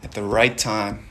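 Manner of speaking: hushed grounding affirmations; guided meditation. Acted. Soft low meditation guiding.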